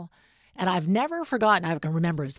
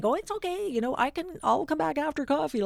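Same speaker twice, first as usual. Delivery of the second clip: much higher, timid and apologetic; meek high intern; 10 semitones higher than usual. Retold or imitated. Young intern to irritated author, shrinking high and timid. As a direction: back off politely in a small high voice. Nervous intern backing off politely.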